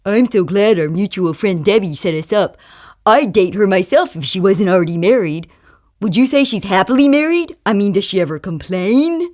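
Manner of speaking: put-on smarmy jerk on a date; jerky date. Jerky guy to his blind date about her friend, put-on jerk voice. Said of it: "some jerky guy. He says something like".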